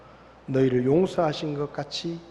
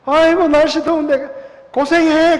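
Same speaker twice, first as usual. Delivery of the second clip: higher, louder sarcastic farewell; mock sympathy; 10 semitones higher, 8 dB louder, slower than usual. Leaving his stranded enemy behind, higher, louder sarcastic farewell. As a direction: fake concern, dripping sarcasm.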